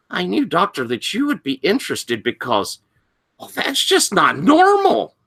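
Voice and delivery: high, keen student; teenaged boy voice. Acted. Wide-eyed student confiding in the doctor, teen voice. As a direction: build to a high, amazed finish. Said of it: "he's telling this salacious tale".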